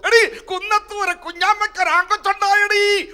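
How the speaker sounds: very high, loud excited call; calling out news. Retold or imitated. Village woman shouting that a baby boy was born, high and loud. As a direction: shout the news across to the neighbours. Neighbour calls out the birth news.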